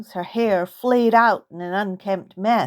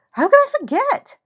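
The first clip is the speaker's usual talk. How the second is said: surprised self-reproach; 4 dB louder than usual. Acted. Sudden exclamation to self.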